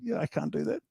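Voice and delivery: small, high, hesitant voice; mock timid self-doubt; timid doubter. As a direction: sound like the scared inner voice backing off. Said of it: "that's the line that says".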